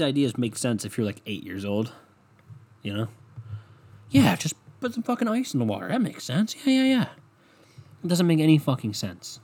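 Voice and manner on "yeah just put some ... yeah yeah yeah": mocking. Mocking the ice idea.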